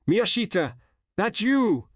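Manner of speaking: high-pitched call across the roof; yelled. A narrator's reading. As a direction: yell it out in startled recognition. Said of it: "I yelled".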